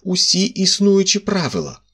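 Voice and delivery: indignant rebuke. A narrator's reading. Magistrate scolding the narrator for breaking all the rules. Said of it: "вигукнув слідчий обурено".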